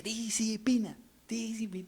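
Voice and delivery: light childish mock sermon; child preaching. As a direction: imitate the small daughter preaching softly. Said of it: "Decía".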